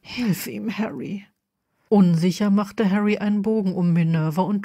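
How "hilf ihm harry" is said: soft, hushed urging; subdued appeal, much softer; quiet plea. A narrator's reading. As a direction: ask gently, barely voiced. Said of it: "sagte Minerva leise".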